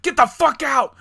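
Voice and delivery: high, loud mock shout; acted furious yell. Game homeowner to intruding player, hammed-up angry yell. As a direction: throw the intruder out at full volume.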